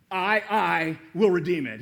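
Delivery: repeating the promise with excitement; excited. Retold or imitated. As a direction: let the excitement burst through on "will". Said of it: "he's actually excited".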